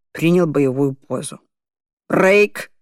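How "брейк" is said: commanding shout. Acted. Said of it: "скомандовала Адольфовна".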